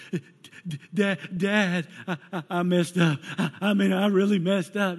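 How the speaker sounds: high, loud, stammering panicked confession; upset daughter. Retold or imitated. Daughter confessing to her dad on the phone, high and panicked. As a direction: blurt it out like a scared teen who wrecked a car. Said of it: "she was so upset".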